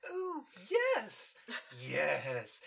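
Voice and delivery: flustered agreement. Retold or imitated. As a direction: squeak it like a caught-out lady. Co-host voices flustered Rarity.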